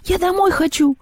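very high crying-out; hysterical wail. A narrator's reading. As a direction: wail like a scared child. Маша в истерике. (Маша в истерике кричит)

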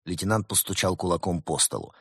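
plain reading voice

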